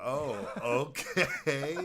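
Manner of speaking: awkward okay through a laugh; laughing reluctant okay. Retold or imitated. Guest giving in to the pushy host, laughing.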